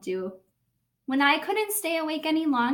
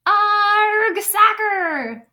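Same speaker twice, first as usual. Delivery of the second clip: loud, much higher crew echo; squeaky crew shout; crew yelling; 7 semitones higher, 8 dB louder, much slower than usual. Acted. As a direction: cheer it like the crew. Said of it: "the crew yelled".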